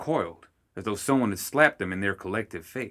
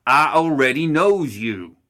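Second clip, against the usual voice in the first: slow, loud, fed-up snap; exasperated outburst; 5 semitones higher, 8 dB louder, slower than usual. Acted. Summer snapping at his son Lenny, loud and slow. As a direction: bark it slowly at the idiot son.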